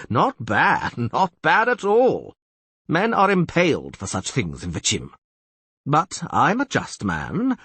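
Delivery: threatening castellan. Acted.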